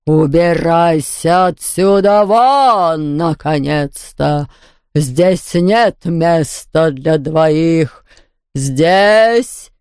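slow, drawn-out villain voice; menacing ghost baroness. A narrator's reading. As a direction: stretch the vowels in a menacing order to leave. Furious ghost baroness ordering intruder out.

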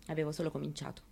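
usual speaking voice

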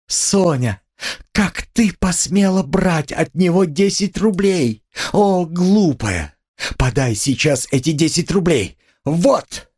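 high, indignant outcry with drawn-out words; frantic scolding. A narrator's reading. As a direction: scold someone dear in a frenzy. Катерина в ярости корит Соню. (высоким голосом, гневно отчитывая)

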